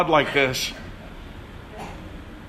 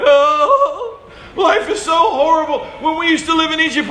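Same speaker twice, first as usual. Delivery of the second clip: high, nasal-free mock whine, fast at the end; whining Israelites; 8 semitones higher than usual. Preacher mocks Israelites crying in their tents, high whine. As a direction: whimper like spoiled complainers. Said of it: "they're crying".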